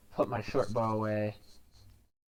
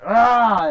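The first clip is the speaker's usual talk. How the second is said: loud sudden war cry; menacing yell; 13 semitones higher, 20 dB louder than usual. Pretend. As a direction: bellow to scare the monster. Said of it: "yell at it".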